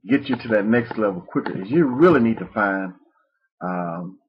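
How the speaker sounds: ordinary talk, nothing marked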